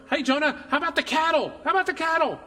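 high-pitched, exclaimed question; high, mock-insistent appeal; playful prodding. God prodding Jonah about the cattle.

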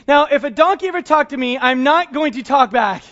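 ordinary talk, nothing marked

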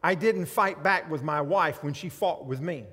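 loud, proud boasting to God; loud self-satisfied prayer; self-righteous prayer. Acted. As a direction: pray like a smug Pharisee showing off. Preacher voicing a self-righteous prayer.

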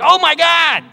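high, loud mocking "Oh my God"; mock curse. Preacher mocking the Witnesses' reading, high and loud. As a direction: toss off a loud careless exclamation. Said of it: "He's cursing".

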